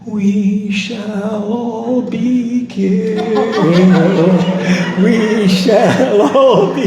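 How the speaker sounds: high, slow, trembling voice; quavering voice. Retold or imitated. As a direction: quaver it out in fear, slowly. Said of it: "suddenly this quavering voice goes".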